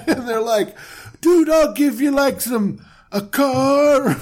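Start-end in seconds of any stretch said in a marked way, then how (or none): 1.2-4.2 bribing bro. Pretend. Voicing Egyptian bribing Abram.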